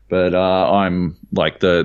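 usual speaking voice

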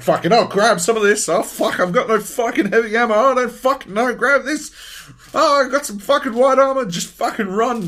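high, loud, rushed panic; frantic looting panic. Retold or imitated. Gamer looting mid-firefight, loud high frantic panic. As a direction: scramble the words out in a panic. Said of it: "I'm like".

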